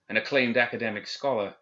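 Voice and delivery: ordinary delivery, nothing marked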